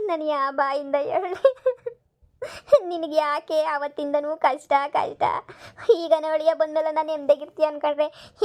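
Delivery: slow sobbing lament, words dragged out; tearful wailing. Acted. Mother too distraught to tell her daughter the news, wailing. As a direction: cry through every phrase, stretching the ends. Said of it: "ಯಾಕೆ ಅಳ್ತೀಯ".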